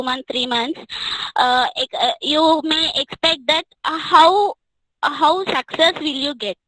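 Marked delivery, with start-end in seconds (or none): none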